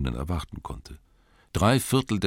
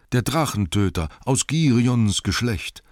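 higher, louder praise of aus Girions Geschlecht, Girions stressed; crowd praising hero; 8 semitones higher, 5 dB louder than usual. Acted. Townsfolk praise dead Bard's lineage, raised admiring voice. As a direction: hail Girions as a lineage of kings. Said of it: "sagten sie alle".

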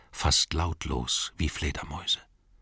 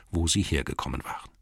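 plain reading voice

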